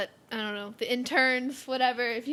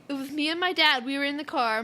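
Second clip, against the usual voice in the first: ordinary talk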